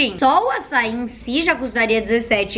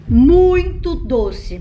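muito stretched slowly, louder; emphatic; 2 semitones higher, 4 dB louder, much slower than usual. Real, not acted. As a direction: drag out muito doce slowly. Reacting to the sweet taste. ("muito doce" bem arrastado)

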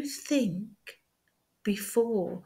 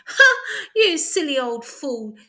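mocking laugh; 6 semitones higher, 9 dB louder than usual. A narrator's reading. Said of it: "The hare laughed loudly".

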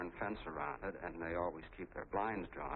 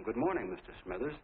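mild, friendly call across the yard; a modest raised-voice greeting, retold; 3 semitones higher than usual. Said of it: "I yelled over to him".

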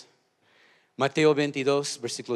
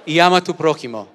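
higher, much louder shouted call; 5 semitones higher, 14 dB louder than usual. Shown as a demonstration. Pastor leading the church in one more shouted call. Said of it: "Una vez más".

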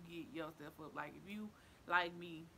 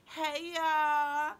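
much higher and louder, a big hyped greeting; excited shout; 9 semitones higher, 15 dB louder than usual. Acted. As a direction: yell it out like the crowd is there.

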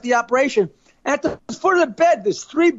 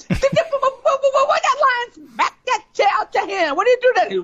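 high-voiced friend; 11 semitones higher than usual. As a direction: draw the question out up high. Friend asks what next.

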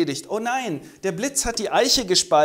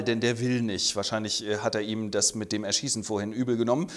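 usual speaking voice

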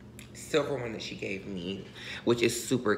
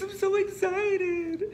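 much higher, slow squeal; excited squeal; 5 dB louder, slower than usual. Real, not acted. Squealing to viewers over her excitement. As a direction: stretch it out with glee.

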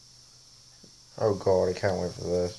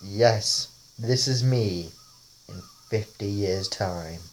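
normal talking voice

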